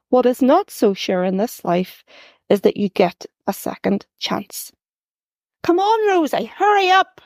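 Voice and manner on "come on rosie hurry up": high, urgent call up the stairs; flustered mum calling from downstairs; mother shouting. A narrator's reading. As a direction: hurry the child out the door. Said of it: "my mother shouting from downstairs".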